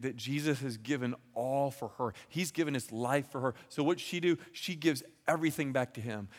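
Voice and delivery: normal talking voice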